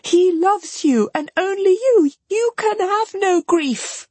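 acted frantic despair; wild cry. A narrator's reading. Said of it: "cried Marianne wildly".

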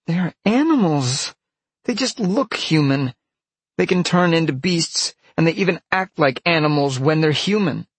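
put-on high, loud girl's protest; indignant girl. A narrator's reading. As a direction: argue back shrilly, insisting on it. Peg argues they are animals.

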